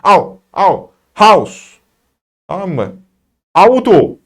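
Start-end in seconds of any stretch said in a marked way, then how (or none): 0.0-1.8 drawn-out "Au, au" then loud "house" with "au"; au sound demo. Shown as a demonstration. Teacher sounding out the au diphthong to students. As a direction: glide "Au, au" into "house". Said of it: "A ile U'yu birleştiriyorsunuz".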